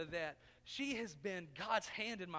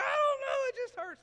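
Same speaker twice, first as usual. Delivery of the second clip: put-on high, loud whiny sob of a hurt kid; high crying child; 12 dB louder than usual. Preacher as a child answering granny through tears. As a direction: cry it out like a four-year-old. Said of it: "I'm like".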